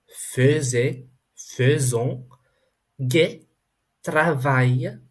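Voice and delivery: French words spoken one at a time with pauses; careful isolated word-list reading; pronunciation demo. Shown as a demonstration. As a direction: model each French word distinctly for learners. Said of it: "let's go over these words again".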